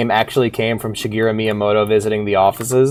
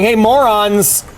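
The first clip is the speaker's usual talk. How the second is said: Samus yelling; 7 semitones higher, slower than usual. Retold or imitated. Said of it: "just like her going".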